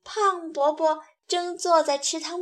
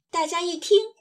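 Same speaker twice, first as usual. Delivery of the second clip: usual speaking voice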